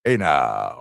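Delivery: ordinary delivery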